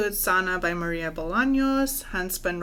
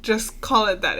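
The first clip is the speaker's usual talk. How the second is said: normal talking voice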